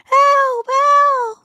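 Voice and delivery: very high, loud cries; piercing storybook cry for help; shouting for help. Acted. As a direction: holler for rescue, drawing it out. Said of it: "heard someone shouting".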